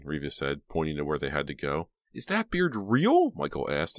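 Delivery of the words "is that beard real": put-on high, drawn-out childlike question; little boy voice. A narrator's reading. Little brother asks Reva about Santa's beard, childlike. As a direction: ask in a high, curious child's voice.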